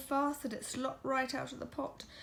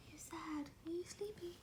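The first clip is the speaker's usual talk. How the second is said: breathy check-in, barely voiced; whisper; 5 semitones higher, 11 dB quieter than usual. Real, not acted. Parent whispering to a sleepy child at bedtime. Asks child quietly during story.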